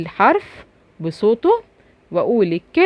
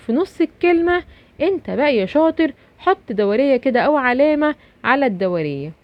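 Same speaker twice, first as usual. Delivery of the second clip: ordinary delivery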